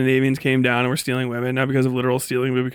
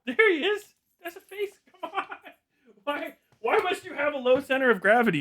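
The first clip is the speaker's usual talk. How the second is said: much higher cooing pet voice with laughs; pet talk; 12 semitones higher, 5 dB quieter than usual. Real, not acted. Cooing at the pet, high laughing baby talk. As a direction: coo at him, laughing.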